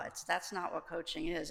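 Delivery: normal talking voice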